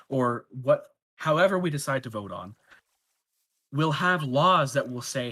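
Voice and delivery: usual speaking voice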